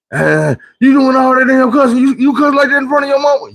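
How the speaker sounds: high, scandalized busybody voice; nosy grown-up. Retold or imitated. Grown folks in the street scolding him for cussing, high-pitched. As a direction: play a shocked stranger tutting at him.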